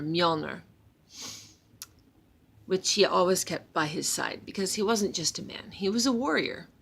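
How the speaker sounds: plain reading voice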